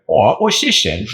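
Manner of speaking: mock British surprise at a find; silly British accent. Grappler distracting opponent as a hand comes through, mock British accent. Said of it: "say something silly in said accent".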